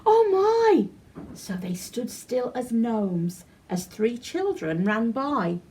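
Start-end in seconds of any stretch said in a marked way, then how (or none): 0.1-0.9 high, startled fairy crying out; frightened cry, rising sharply; alarmed cry. Acted. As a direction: panic as someone approaches. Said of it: "The Tooth Fairy cried".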